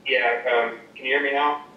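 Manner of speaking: ordinary delivery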